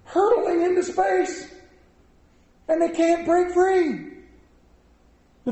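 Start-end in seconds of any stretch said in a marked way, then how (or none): 0.0-4.1 dramatic build. Real, not acted. Dramatic climax of satellite story.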